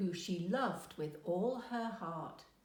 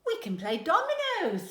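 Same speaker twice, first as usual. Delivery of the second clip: much higher, louder keen suggestion; eager offer; 12 semitones higher, 8 dB louder than usual. A narrator's reading. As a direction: jump in first. Grannies vie over games.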